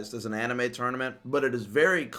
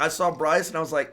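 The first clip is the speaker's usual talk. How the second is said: usual speaking voice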